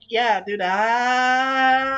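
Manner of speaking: long, loud "aah" held out; mock directed yell. Imagined director coaching a voice actor, mock yell. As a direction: mimic a bad director's demand for yelling.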